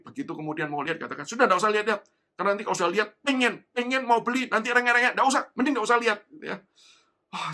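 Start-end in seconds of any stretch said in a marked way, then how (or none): 1.3-6.2 loud, high mock scolding of a child; a mother nagging her kid not to look. (ibu memarahi anak, suara keras dan tinggi)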